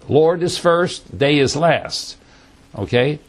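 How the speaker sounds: usual speaking voice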